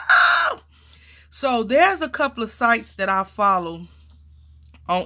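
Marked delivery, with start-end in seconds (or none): 0.1-0.6 high, loud delighted "Oh!"; excited gasp. Retold or imitated. Crafter retelling her thrill at the find, loud excited "Oh!". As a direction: burst out in surprised joy. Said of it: "I was like".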